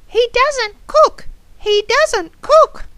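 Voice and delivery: high squawky parrot chant. Acted. Parrot chiming in about the cooking, high parrot voice. Parrot mimics in a children's story.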